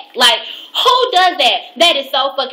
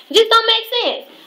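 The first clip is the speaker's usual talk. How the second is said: high, exasperated complaint; getting mad; 11 semitones higher than usual. Real, not acted. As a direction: let the irritation rise. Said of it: "I'm getting mad thinking about it".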